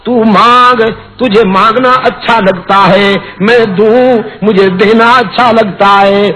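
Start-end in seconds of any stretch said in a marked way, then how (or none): none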